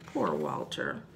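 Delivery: soft, low pity; hushed, sorry storyteller; sympathetic. Acted. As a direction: murmur it sorrowfully, for small children. Sympathising with the characters.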